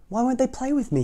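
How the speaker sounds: a small, high, sad little wonder; sad duckling. A narrator's reading. The ugly duckling wonders why nobody plays, high and sad. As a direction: ask it like a lonely baby bird. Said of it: "he wondered sadly".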